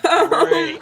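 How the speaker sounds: loud, high fake delight; mock enthusiasm. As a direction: belt out a mock-thrilled reply. Mock reaction to crazy suspect.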